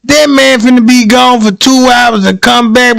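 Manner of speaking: usual speaking voice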